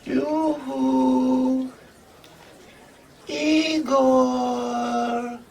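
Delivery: high, drawn-out singsong call; Igret. Acted. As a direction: coo out for your sweetheart from afar. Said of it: "Igret say to me, she say".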